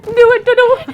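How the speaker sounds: high giggly imitation of a robot; laughing mock robot. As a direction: copy the robot voice through laughter. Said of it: "You sound like a robot".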